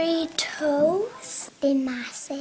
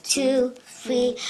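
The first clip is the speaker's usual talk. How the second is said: ordinary delivery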